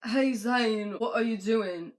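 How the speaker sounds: lightly put-on British accent. Harry greeting Zayn in the imagine, mock British accent. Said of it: "if I can do the British accent".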